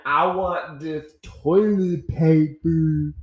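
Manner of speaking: high, loud, drawn-out dog voice; big dopey pet voice, words stretched out; Zoe. Acted. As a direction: whine for food as a talking dog, slowly. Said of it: "That's what Zoe's voice sounds like".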